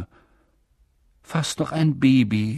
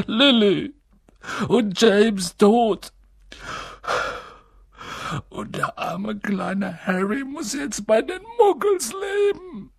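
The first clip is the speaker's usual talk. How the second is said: wailing grief; 11 semitones higher than usual. A narrator's reading. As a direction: cry it out, overwhelmed. Hagrid breaking down crying.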